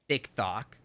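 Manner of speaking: TikTok with lisped TH for each T, "thick thock"; softened T. Shown as a demonstration. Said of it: "How do you make a T less sharp?".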